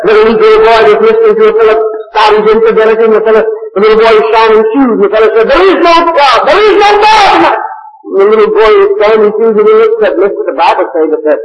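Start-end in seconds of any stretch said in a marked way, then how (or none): 5.5-7.5 mock infidel shout. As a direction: bellow it like a scoffing infidel. Infidel fellow shouting at shoe boy.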